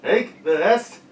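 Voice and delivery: loud, mangled by a mouth guard; mouth guard speech. Real, not acted. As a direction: push words past a mouth guard. Mouth guard guessing game.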